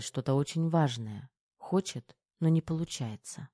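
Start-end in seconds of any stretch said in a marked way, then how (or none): none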